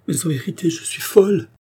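high-pitched, rising agitation; distraught. A narrator's reading. Andrée overwhelmed by Charny's words, distraught. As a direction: exclaim as if losing your grip.

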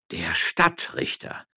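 slow, hushed, breathy repetition; awed, drawn-out murmur; astonished echo. A narrator's reading. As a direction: echo it in a stunned near-whisper. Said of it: "wiederholten alle".

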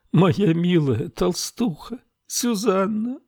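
very high, slow tender lament; grieving. A narrator's reading. Camilla lamenting over her dead friend. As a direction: cry the name out lovingly.

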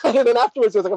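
ordinary delivery

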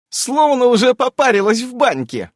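high-pitched half-cry of relief; relieved, slightly hysterical. A narrator's reading. Irene sees Ulyanka alive, relieved with hysterical notes. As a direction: let relief tip towards hysteria. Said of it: "но и с истерическими нотками".

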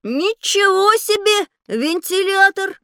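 high, drawn-out wow of amazement; amazed. Acted. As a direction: stretch it out slowly, pitched high in wonder. Boy amazed at fan size. (изумлённо, высоко и протяжно)

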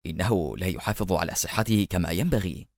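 low, soft muttered aside. A narrator's reading. The worried guard mutters to himself about Sajjal, softly muttered. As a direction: mutter it under your breath, worried. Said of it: "ثم لم يلبث أن تمتم".

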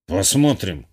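low, grudging grunt; displeased grumble. A narrator's reading. Professor grudgingly answers the narrator's advice, grumbling. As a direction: grumble it reluctantly, low in pitch. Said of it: "недовольно буркнул профессор".

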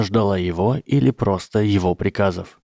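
usual speaking voice

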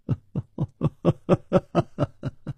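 a full open laugh, voiced in character; knowing chuckle of recognition; hearty laugh. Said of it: "楚留香笑着说".

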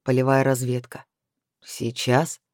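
low grumble to himself; growled. A narrator's reading. Chris muttering about the field mission, growling. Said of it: "прорычал он".

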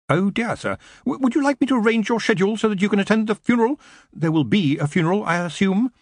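high, hurried, tentative underling; nervous wizard's aide, high-voiced; anxious assistant.

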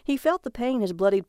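plain reading voice

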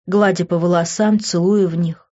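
plain reading voice

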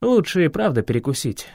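ordinary delivery, nothing marked

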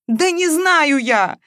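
high, loud, drawn-out wail; tearful, exasperated outburst; sobbing. A narrator's reading. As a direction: cry it out helplessly. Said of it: "снова всхлипнула Маша и тихонько завыла".

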